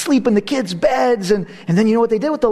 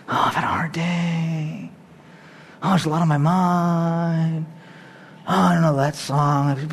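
slow, low, mopey sighing complaints; mock weary complaint; 4 semitones lower, 4 dB quieter than usual. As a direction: play a tired churchgoer making excuses. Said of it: "it's like".

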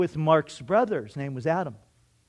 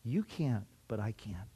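much softer, lower, calm reassurance; gentle voice of God; 7 semitones lower, 11 dB quieter than usual. Retold or imitated. God answering a desperate young man, soft and reassuring. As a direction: speak it softly like a still small voice. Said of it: "God spoke to my heart and said".

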